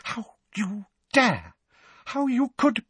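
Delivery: high, slow, outraged gasp of words; enraged, spluttering disbelief; incandescent rage. A narrator's reading. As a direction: pitch it high and drag out each word in outrage. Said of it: "incandescent with rage".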